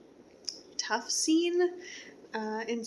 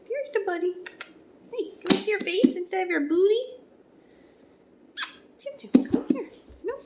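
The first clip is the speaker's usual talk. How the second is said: talking to a dog; 9 semitones higher, 6 dB louder than usual. Real, not acted. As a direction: coo at the dog in a high voice. Greeting the dog Tim.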